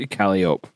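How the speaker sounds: "Calliope" ending in -ope, silent final e; "Calliope" stressed up front, ending like rope; Calliope as KAL-ee-ope. Shown as a demonstration. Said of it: "what I'm just gonna call it".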